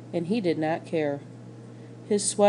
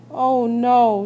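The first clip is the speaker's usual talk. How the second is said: louder, stricken cry of loss; anguished cry; 4 semitones higher, 8 dB louder than usual. A narrator's reading. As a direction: cry out as the truth hits. Bryce finds sister stopped breathing.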